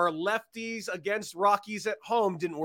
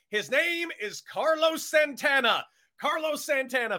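shout; 10 semitones higher than usual. Real, not acted. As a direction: announce the name like a big reveal. Big reveal of the pick.

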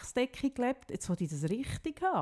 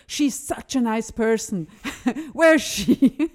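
slow, louder imitation of the cleaner breaking into laughter; laughing cleaner imitation; 2 semitones higher, 8 dB louder, slower than usual. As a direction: mimic her gushing while laughing. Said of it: "hat sie gesagt". (lachend nachgeäffte Putzfrau)

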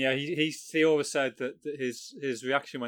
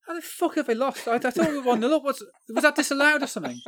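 much higher, louder incredulous outburst, laughing through it; retold disbelief; 12 semitones higher, 8 dB louder than usual. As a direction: replay your baffled shock at the scoreline, pitched way up. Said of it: "my initial reaction was".

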